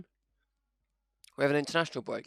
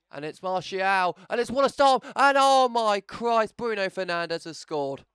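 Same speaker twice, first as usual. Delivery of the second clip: higher, louder, slow commentary shout; hammed-up goal commentary cry; mock commentator goal call; 7 semitones higher, 6 dB louder, slower than usual.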